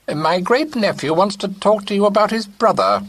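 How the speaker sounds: put-on an old great-aunt giving orders; imperious old aunt. A narrator's reading. Great-aunt commanding her companion, high and imperious.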